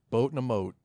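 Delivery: usual speaking voice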